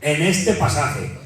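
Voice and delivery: slow, deliberate "este" pressed; stress on este. Real, not acted. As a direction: lean hard on "este".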